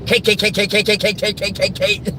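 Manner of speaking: re-enacted high, rattling staccato gag; silly rapid repetition. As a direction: rattle the word off high as a prank.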